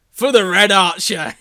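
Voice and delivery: loud, proud hero's cry; heroic catchphrase. Acted. Reader voicing Troy's cheesy battle cry, heroic catchphrase. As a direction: call it out like a catchphrase.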